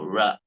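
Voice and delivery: "ra" with a very light English r; barely touch the r in "ra". Shown as a demonstration. Teacher contrasts the light English "ra" for students. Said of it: "very light sound".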